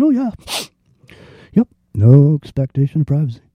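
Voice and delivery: lightly voiced sheep-like acceptance, "No expectation of privacy" tossed off; mock resigned shrug. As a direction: imitate people meekly giving in. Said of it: "just rolled over and just went".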